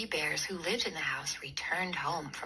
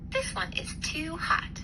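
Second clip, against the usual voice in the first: high whispered complaint; a little girl fussing at hot food; girl complaining the porridge is too hot; Goldilocks voice; 10 semitones higher than usual. Acted.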